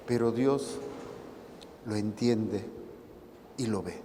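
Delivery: usual speaking voice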